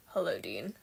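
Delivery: low Castiel greeting; Cass voice. Shown as a demonstration. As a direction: drop it down for a lightly voiced Castiel. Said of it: "learned how to do a [name] impression and sing".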